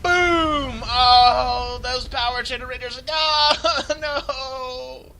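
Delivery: very loud, high anguished cries; shocked dismay. Real, not acted. Caster watching the bomb wipe out his base, loud anguished cries. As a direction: react to the blast in horror.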